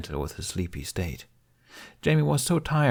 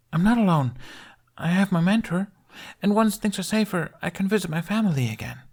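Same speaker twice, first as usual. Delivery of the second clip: put-on high, eager little boy voice; a small brave kid speaking up; voiced young boy; young boy Jamie; 12 semitones higher than usual. Child character voice.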